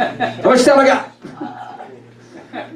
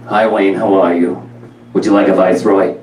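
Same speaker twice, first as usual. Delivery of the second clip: deadpan mom impression in a lowered voice; Harvey Fierstein-like mother voice; Rona; 3 semitones lower than usual. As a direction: greet him with flatlined politeness. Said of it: "could never tell if she won the lotto".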